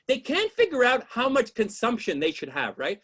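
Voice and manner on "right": normal talking voice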